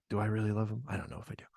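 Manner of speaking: low, very soft mock-confidential murmur; hushed aside. Host privately doubting he loves Stu, hushed aside. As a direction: mutter it quietly, as if privately doubting.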